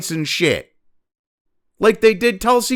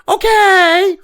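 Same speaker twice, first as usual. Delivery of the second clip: slow, high, drawn-out "Okay"; mock compliant okay; 12 semitones higher, 6 dB louder than usual. Mother's advice to just agree: mock okay. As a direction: sound like a child meekly going along. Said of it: "my mother told me".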